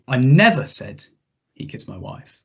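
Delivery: never 힘주어 말하고 he kissed my wife는 작게 떨어뜨리기; stress on never. Shown as a demonstration. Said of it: "see how the meaning shifts with a different emphasis".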